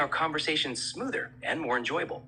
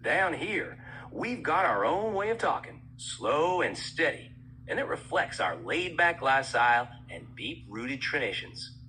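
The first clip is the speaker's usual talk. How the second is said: laid-back southern US talk with stretched vowels; southern US accent. Shown as a demonstration. Voice assistant touring accents for the user, southern US accent. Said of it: "Now, in a southern U S accent".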